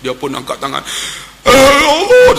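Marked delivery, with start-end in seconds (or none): 1.5-2.4 high loud cry of "Allah"; old man's cry. Retold or imitated. An old pilgrim cries out while praying at the Prophet's mihrab. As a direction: cry out to God in raw emotion. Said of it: "Dia teriak".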